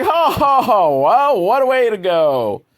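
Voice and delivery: high, loud fake chuckle; mock crowd laugh. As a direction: ham up a room laughing at the joke. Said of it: "everyone laughs".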